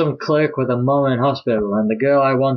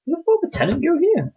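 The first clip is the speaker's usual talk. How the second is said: character voice. Pretend. Reading game dialogue aloud.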